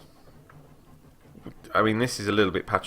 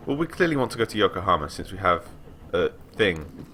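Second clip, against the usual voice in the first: usual speaking voice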